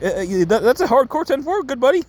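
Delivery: very high, gleeful cartoon villain voice; Joker. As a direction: answer in a giddy, squeaky put-on pitch. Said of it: "He's like".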